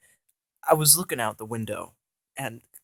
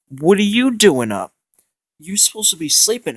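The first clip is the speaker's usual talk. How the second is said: higher, louder nagging voice of the boots; scolding boots; 6 semitones higher, 8 dB louder than usual. Retold or imitated. Boots scold their owner for being up at night. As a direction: nag him like a parent catching a kid up late. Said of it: "and they go".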